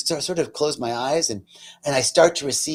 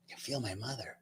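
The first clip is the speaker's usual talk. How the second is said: hushed, moved; 3 semitones lower, 18 dB quieter, faster than usual. Real, not acted. Repeats it, moved, much softer.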